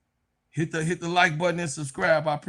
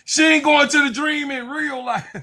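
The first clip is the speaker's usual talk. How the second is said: high and loud, laughing through the words; loudly mocking the idea through laughter; 10 semitones higher, 5 dB louder than usual. Real, not acted. Laughs at a player's rumour.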